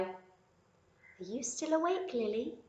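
lightly voiced mother checking on her bunny; gentle mother rabbit. Mother Rabbit softly asks Lily if she is awake.

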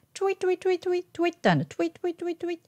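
high, soft, rapid "twee" chirps in トゥイ; bird twitter. Shown as a demonstration. Teacher showing the bird-like feel, high quick トゥイ chirps. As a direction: tweet トゥイ like a little bird, English tw. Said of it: "こんな感じなんですよね".

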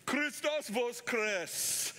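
a lightly voiced old peasant, deliberate; old man's greeting. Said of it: "the man just stood there and said".

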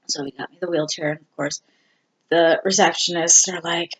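ordinary delivery

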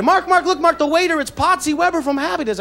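high thrilled calling out, imitating mom; a mother sure she sees a star; excited mom. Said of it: "she gets all excited".

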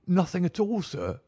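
high-pitched protesting servant; anxious servant. A narrator's reading. Servant Bannister answering Holmes's questioning.

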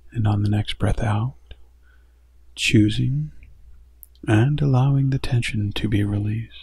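gentle meditation guide growing hushed; guided meditation. Acted.

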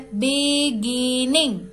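Beginning in three stretched, separate beats; beginning divided into three parts for counting; split into syllables. Shown as a demonstration. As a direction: sound out beginning slowly, syllable by syllable. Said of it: "तीन भागों में बांटा जा रहा है".

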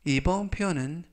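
ordinary talk

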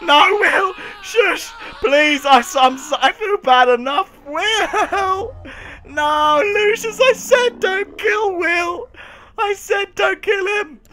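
high, hammed-up tearful protest; mock anguished wailing. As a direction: wail in exaggerated grief. Wails as favourite character dies.

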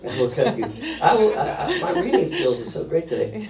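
amused at own slip; laughing. Real, not acted. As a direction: chuckle through the words, self-mocking.

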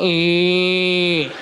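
low grunting cow noise; mock cow grunt.

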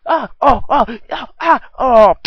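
loud high yelps of falling; pained cries. Acted. Henchman tumbling down the stairs, loud pained yelps. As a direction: cry out tumbling down.

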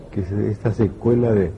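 ordinary talk

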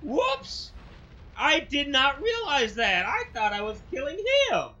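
high yelped start, then loud protest; loud, startled admission of a blunder; loud dismay. Real, not acted. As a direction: blurt out the mistake at full volume. Realizing a mistake in game.

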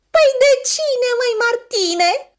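high, loud teasing seal; high mocking question; jeering seal voice. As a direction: jeer at him in a squeaky seal voice. Seals jeer at Martin.

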